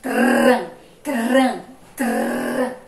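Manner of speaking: Tran repeated with a tapped R, loud; tapped R drill. Shown as a demonstration. Teacher drilling the tapped R in Trânsito. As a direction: flick the tongue for the R in Tran. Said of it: "Linguinha tremeu".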